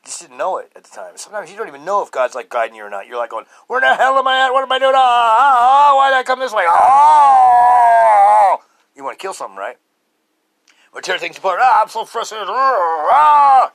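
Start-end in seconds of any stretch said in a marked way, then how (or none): 3.7-8.6 loud, high exasperated yells; frustrated yelling. Retold or imitated. Man yelling at himself, lost and frustrated, loud. Acting out frustrated self-talk. 11.6-13.7 loud, high frustrated outburst; frustrated groans. Retold or imitated. Venting frustration with loud, high groans.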